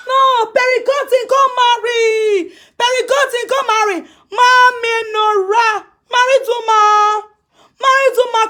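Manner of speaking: high, long-held singsong calls; jeering taunt. Real, not acted. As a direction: call it out high, stretching the last syllables. Jeering at someone with drawn-out calls.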